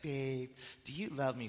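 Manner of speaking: cutesy wheedling. Retold or imitated. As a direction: imitate her cute pleading ask. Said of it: "She's crunching up her nose like that".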